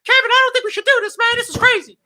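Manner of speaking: extremely high, loud panicky squeal; frantic mock pleading in a squeaky voice; Jimmy Hart. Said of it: "fucking Jimmy Hart is like".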